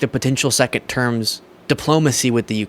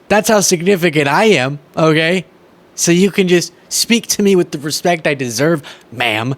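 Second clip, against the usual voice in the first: mock Kari Lake; 4 semitones higher than usual. Host parodying Kari Lake scolding the reporter, haughty mock outrage. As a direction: lord it over the reporter.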